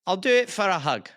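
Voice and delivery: Scottish merchant striking a deal; put-on Scottish shopkeeper; Scottish accent. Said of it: "do you do a Scottish accent".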